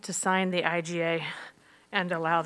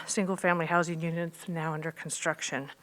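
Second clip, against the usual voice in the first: normal talking voice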